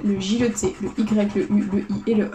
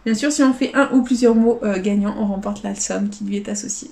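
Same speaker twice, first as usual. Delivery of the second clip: ordinary delivery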